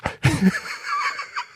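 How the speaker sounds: high wheezing burst of laughter; high helpless laugh. Real, not acted. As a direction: crack up high. Laughs at own story.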